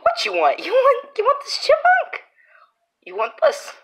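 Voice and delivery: very high squeaky taunt at the cat; high teasing voice. Real, not acted.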